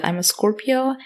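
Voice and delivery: ordinary talk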